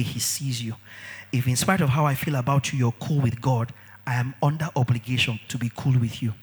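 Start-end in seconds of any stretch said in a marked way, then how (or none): none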